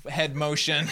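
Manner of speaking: ordinary talk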